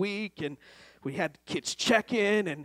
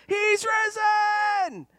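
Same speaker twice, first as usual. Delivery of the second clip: long, high, drawn-out shout; stretched jubilant call; joyful shout; 14 semitones higher, much slower than usual. Retold or imitated. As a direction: cry it out like the teacher. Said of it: "the teacher would say".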